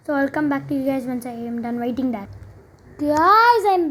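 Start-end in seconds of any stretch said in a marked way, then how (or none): 3.0-3.9 calling out. Real, not acted. Calling out to viewers.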